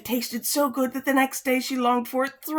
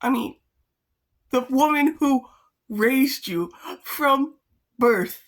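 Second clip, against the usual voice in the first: incredulous; 5 semitones higher, 3 dB louder than usual. Real, not acted. Incredulous aside about the story.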